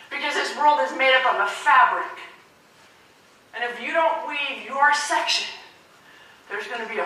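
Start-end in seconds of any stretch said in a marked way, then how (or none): none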